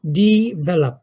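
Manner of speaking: "ডেভেলপ" with a short i and e, taught to all; correct pronunciation. Shown as a demonstration. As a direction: teach "ডেভেলপ" firmly to the class. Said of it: "সবাই জেনে নাও".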